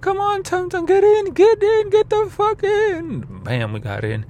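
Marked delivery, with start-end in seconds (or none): 0.0-3.2 much higher and louder, pleading; high shouted coaxing, slowing at the end; friend's voice. Retold or imitated. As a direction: friend yelling at the drunk rider to get in. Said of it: "He's like".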